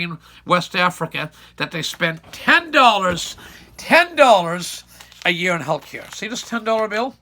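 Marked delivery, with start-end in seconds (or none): 2.3-4.8 "ten" hit higher and louder, slowly drawn out; stress on ten. Real, not acted. Speaker stressing "ten" to shock the audience. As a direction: lean hard on "ten dollars", slowly. Contrasting health spending per person.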